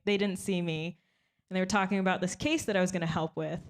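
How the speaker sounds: ordinary delivery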